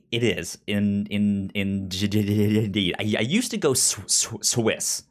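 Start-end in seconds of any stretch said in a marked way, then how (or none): none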